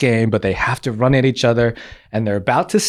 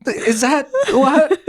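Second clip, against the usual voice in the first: high, slow, baffled laughing what; high bemused mock confusion; Jim from The Office; 12 semitones higher, much slower than usual. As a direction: play the awkward deadpan look. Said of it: "Was I just like".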